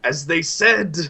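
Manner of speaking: high, loud, frantic voice of a crazed man; a mad wanderer raving at the campfire; manic madman. Acted. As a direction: cry it out like a man gone mad with triumph. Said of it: "and he's still cackling".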